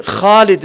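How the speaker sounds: throat-heavy Khalid, exalted. Shown as a demonstration. Teacher demos the big heavy Kha in Khalid. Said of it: "Kha is a big letter".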